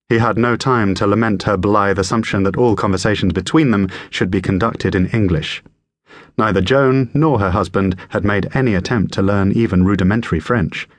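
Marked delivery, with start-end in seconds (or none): none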